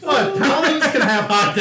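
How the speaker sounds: laughing. Real, not acted. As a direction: try to say it but crack up. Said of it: "I can't say that without laughing".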